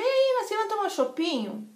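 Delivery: high-pitched, chirpy mimicked friend; upbeat invitation. Friend phones to suggest a beer, upbeat. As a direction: sing out a casual invite to drinks.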